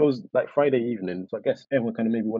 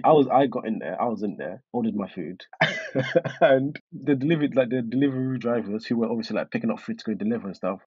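ordinary talk, nothing marked

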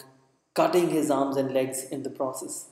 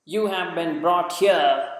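slow, louder, higher official pronouncement; stern governor; 4 semitones higher, 6 dB louder than usual. Acted. Governor announcing to assembled Tibetans, in a stern, slow proclamation. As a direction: announce it coldly to the crowd.

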